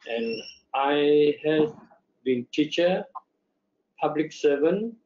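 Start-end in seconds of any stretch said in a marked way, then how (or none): none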